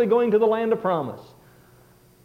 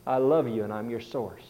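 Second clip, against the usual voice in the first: much lower and softer, gentle; tender; 10 semitones lower, 6 dB quieter than usual. Retold or imitated. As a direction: say it softly like a loving father. God's tender closing words.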